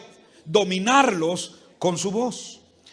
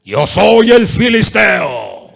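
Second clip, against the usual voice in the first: slow, huge, much louder roar; Goliath's booming shout; 15 dB louder, much slower than usual. Shown as a demonstration. Preacher shows Goliath shouting down his enemies, slow booming roar. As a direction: bellow it like the giant taunting Israel.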